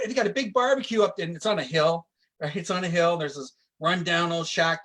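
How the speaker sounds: ordinary talk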